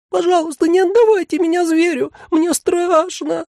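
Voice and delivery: put-on very high childlike whimpering plea; frightened little mannequin, piteous and high; plaintive begging. A narrator's reading. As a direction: beg pitifully in a tiny squeaky voice. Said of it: "жалобно твердил сиреневый манекен".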